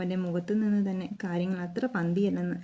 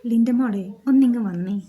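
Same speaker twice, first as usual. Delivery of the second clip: calling out; 3 semitones higher, 8 dB louder than usual. A narrator's reading. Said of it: "Alex ലിൻഡയെ വിളിച്ചു".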